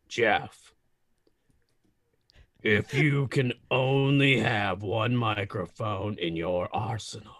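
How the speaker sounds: Darth Vader voice. Acted. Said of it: "That was not even close to Vader".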